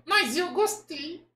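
high, slow puppet voice; Kleber. Acted. Puppet defending his own voice, high puppet voice. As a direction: stretch it out as a high-pitched dummy. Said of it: "essa voz ficou meio estranha".

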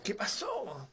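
susurra la pregunta, temiendo lo peor; un susurro quebrado de padre; susurro angustiado. Acted. (susurro de padre que teme la respuesta)

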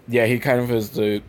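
ordinary delivery

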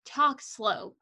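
ordinary delivery

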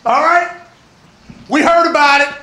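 loud, high hype-man growl; wrestling promo. Acted. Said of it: "It was a cut promo almost, like a wrestling promo".

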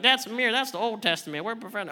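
lightly put-on skeptic voice; a hint of a goofy heckler; Tyler Tarver wannabe voice. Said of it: "I don't know what voice that was".

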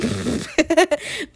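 high burst of laughter; giggle. Real, not acted. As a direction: laugh at your own cheek. Laughs at own sass.

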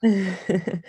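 brief light chuckle; a quick laugh before signing off; short laugh. Real, not acted. Host laughs after guest returns the compliment, short chuckle.

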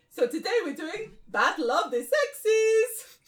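Gary. Acted. Leader announces today's reenactment, in a drawn-out Spanish accent. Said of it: "Gary Spanish".

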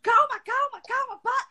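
high flustered calma, calma; flustered teacher. Retold or imitated. Teacher begging the chattering student to calm down, high and flustered.

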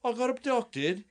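question-like Aussie storytelling; Australian uptalk. Retold or imitated. Mocking Australians who speak in questions, high rising Aussie accent. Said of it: "they say everything as a question".

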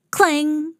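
ordinary delivery

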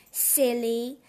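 ordinary delivery

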